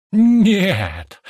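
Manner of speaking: loud, high, drawn cry of pain; agonised scream. A narrator's reading. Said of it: "раздался истошный крик, полный боли". (истошный крик, полный боли)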